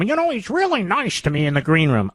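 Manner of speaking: mock guest voice. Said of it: "mocking guests' praise".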